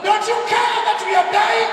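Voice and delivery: shouting in terror at the sleeping Jesus; panicked shout. Retold or imitated. Preacher voicing terrified disciples, shouting to wake Jesus. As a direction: cry out desperately over the storm.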